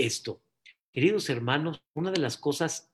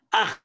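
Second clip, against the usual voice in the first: short, high "aj" cry; sudden high squeal of alarm, mimicked; imitated wife's scream; 5 semitones higher, 3 dB louder than usual. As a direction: mimic a startled yelp. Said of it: "mi esposa grita".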